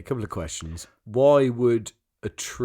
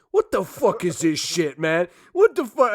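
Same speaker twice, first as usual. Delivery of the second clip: mock angry gangster; 7 semitones higher, 7 dB louder than usual. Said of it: "the bad guy goes like".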